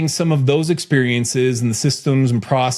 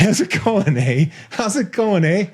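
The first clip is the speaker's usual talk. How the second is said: lightly put-on Canadian greeting, higher pitched; playful mock Canadian lilt; 7 semitones higher than usual. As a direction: imitate a Canadian saying hello. Imitating Canadian elevator greeting.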